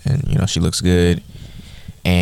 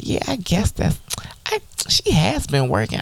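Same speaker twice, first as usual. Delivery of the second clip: higher, softer hesitant Molly voice; imitating Molly; 11 semitones higher, 4 dB quieter than usual. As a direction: lift it up and hedge quietly. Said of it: "And Molly's like".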